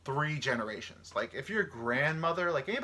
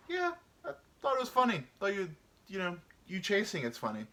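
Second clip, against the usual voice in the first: sheepish reply; 8 semitones higher than usual. Acted.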